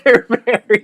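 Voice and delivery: high, loud, slowed mock retelling through a laugh; laughing imitation. Friend echoing the wife's news, laughing high. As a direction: laugh while mimicking her. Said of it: "they said".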